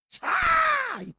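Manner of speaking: very high, loud cry of dismay; loud exclamation. Real, not acted. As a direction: cry out as if struck. Preacher exclaiming at hard point.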